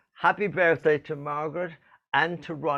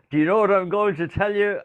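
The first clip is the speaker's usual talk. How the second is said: quick, light Irish lilt; Irish accent; 5 semitones higher, faster than usual. Retold or imitated. Host mimicking Cork Toastmasters in an Irish accent. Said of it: "I get my Irish accent back".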